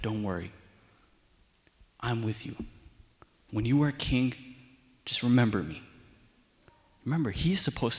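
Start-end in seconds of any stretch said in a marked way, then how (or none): 0.0-5.8 low, soft, reassuring voice; reassuring Jonathan. Retold or imitated. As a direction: gently comfort a worried friend, pausing between phrases. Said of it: "Jonathan's like".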